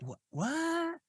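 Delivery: very high, squeaky mock puzzlement; puzzled disbelief. Host retelling his reaction to the accent, puzzled. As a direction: shoot way up high in baffled wonder.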